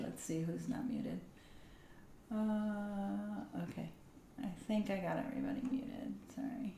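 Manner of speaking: muttering to herself under her breath; soft unvoiced check while fixing mute; whispered aside. Real, not acted.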